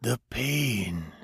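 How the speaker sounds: soft, gentle stranger voice; soothing. A narrator's reading. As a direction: ease his fear. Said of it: "the stranger said soothingly".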